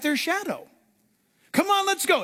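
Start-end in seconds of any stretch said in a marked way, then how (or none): 1.5-2.2 high, keen rallying call; acted high-pitched enthusiasm; eager urging. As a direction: urge your friends to follow. Peter urging others, acted against naysayers.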